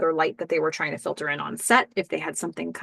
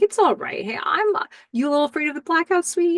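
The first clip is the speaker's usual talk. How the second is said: put-on higher, sing-song baby talk from a dad; dad mode; 10 semitones higher, faster than usual. Billy comforting frightened daughter during blackout, higher baby talk. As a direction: coo to a scared toddler, lightly voiced. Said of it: "Billy instantly switches into dad mode".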